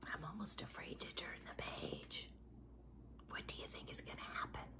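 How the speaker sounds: hushed, fearful whisper to listeners; suspenseful whisper. Acted. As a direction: whisper as if afraid of what comes next. Suspense before turning the page.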